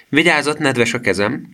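high, crowing little warning. A narrator's reading. Girl offering her elbow for a handshake, crowing. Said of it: "kukorékolta".

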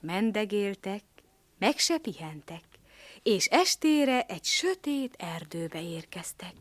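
plain reading voice

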